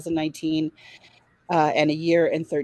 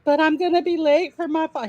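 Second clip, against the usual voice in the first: high, louder pleading to the cop; whiny protest; 11 semitones higher, 5 dB louder than usual. Retold or imitated. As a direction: whine that you'll be late. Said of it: "I'm like".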